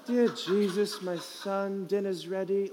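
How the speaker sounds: mock British accent. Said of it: "she talks with a British accent".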